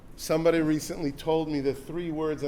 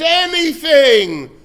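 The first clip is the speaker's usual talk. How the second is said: slow, very loud cry; desperate yes; 8 semitones higher, 11 dB louder, slower than usual. Acted. Man on the branch answers the heavenly voice, shouting. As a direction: swear it with everything.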